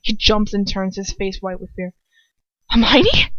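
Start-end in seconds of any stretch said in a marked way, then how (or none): 2.7-3.3 higher, louder questioning name; startled and relieved to see her; relieved surprise. A narrator's reading. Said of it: "he says, smiling in relief".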